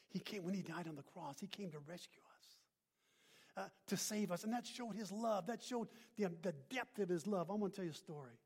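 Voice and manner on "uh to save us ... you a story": ordinary delivery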